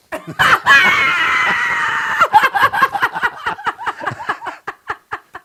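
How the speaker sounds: long, high, loud laughing fit; shrieking laugh that slowly dies down; real uproarious laughter. Real, not acted. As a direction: crack up at your own punchline. Cracks up at own punchline.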